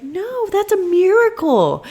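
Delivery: high, slow, syrupy staff voice; gushing reassurance. Retold or imitated. Staff reassure the anxious mother, high and sugary. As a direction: coo it like a delighted nurse.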